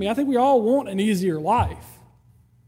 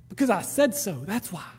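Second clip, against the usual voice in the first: low, soft final word; a hint of a stern father; stern dad; 5 semitones lower, 7 dB quieter than usual. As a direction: close the matter like a father. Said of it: "his answer almost every time was".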